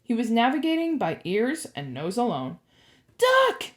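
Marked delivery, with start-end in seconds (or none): 3.2-3.7 very high, urgent yell; high shout from above; yelled warning. A narrator's reading. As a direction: cry out a sudden warning. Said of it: "he yelled again".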